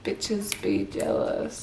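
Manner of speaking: slow, breathy whispered put-down; whispered aside. Real, not acted. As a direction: toss it off under your breath. Muttered dismissive aside.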